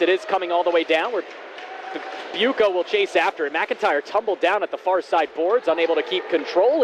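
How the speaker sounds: ordinary delivery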